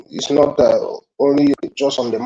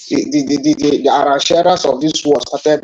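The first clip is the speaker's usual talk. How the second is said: ordinary talk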